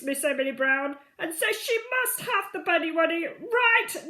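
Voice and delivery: high, loud pompous herald voice; wing commander. A narrator's reading. Wing commander delivering the queen's demand, loud and pompous. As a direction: proclaim it like a stiff royal messenger. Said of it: "The wing commander saluted and said".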